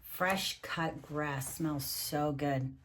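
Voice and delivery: plain reading voice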